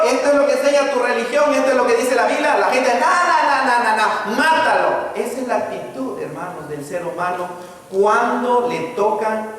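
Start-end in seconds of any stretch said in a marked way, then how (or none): 0.0-2.6 urgent pleading. Acted. Preacher voices a believer urgently correcting the crowd, fast and high. Voicing someone pointing out error. 2.9-4.9 drown out reason like a stubborn crowd; mocking mob. Mocking stubborn religious people.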